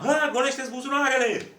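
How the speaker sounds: high, loud command; cop barking an order. Retold or imitated. Police officer ordering the driver, a high, loud command. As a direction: snap it like a power-tripping cop.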